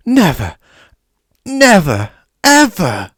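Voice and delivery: high, loud crying protest; high wailing elf; tearful elf. A narrator's reading. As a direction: sob out each never. Dobby sobbing protest.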